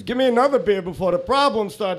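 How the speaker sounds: high-pitched, insistent mock order; demanding bar customer. Customer demands another beer from the bartender, high insistent voice.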